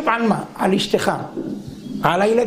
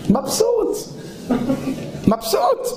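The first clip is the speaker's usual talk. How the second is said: much higher, rising with delight; a high, rising, delighted "mabsut"; blissful; 10 semitones higher, slower than usual. Acted. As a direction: squeal it out with pure contentment. Man blissful at the sea.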